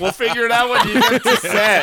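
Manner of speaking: bright, loud reassuring brush-off; cheerful producer voice. Retold or imitated. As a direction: wave it off cheerfully. Acting out the producers.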